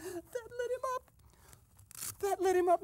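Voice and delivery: high, squeaky, helpless glee; gleeful cackling. Pretend. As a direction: squeal it while cracking up with laughter. Cracking up after the shouted prank.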